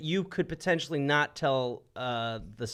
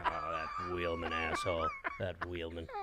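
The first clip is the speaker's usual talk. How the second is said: low, soft muttering; grumbling; 8 semitones lower, 10 dB quieter than usual. Acted. Beaten Confederate about the pilot, mock grumbling mutter. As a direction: mutter it under your breath.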